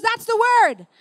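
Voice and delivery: high, loud burst of recognition; excited recognition. Retold or imitated. Woman grabbing the preacher over the word, excited recognition. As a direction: imitate her thrilled outburst.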